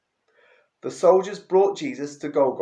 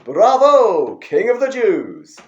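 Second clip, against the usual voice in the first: loud, higher mock bravo; mocking cheer; 5 semitones higher, 10 dB louder, slower than usual. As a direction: jeer him with a fake cheer. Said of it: "Then they began their mockery".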